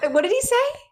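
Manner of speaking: high, bemused put-on voice; lightly voiced baffled man; imitating the staff. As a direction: play the puzzled staffer. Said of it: "And he goes".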